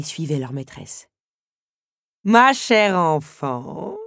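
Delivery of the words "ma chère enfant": slow, louder, higher countess voice; stately countess greeting a dear guest; countess's cry. A narrator's reading. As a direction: draw out a grand welcome. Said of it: "s'écria la comtesse".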